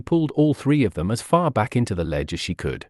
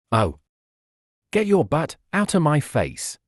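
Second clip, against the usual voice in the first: a mild disgusted outburst, a touch higher; disgusted exclaim; 4 semitones higher than usual. A narrator's reading. Courage to Noelle on his face, mildly disgusted. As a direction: push him off your face in disgust. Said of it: "Courage exclaimed in disgust".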